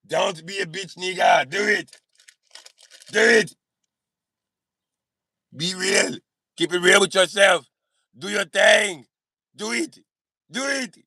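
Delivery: put-on punchy Austrian-accented pep talk; Arnold Schwarzenegger accent. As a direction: urge them on in a Schwarzenegger accent. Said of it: "doing an Arnold Schwarzenegger accent".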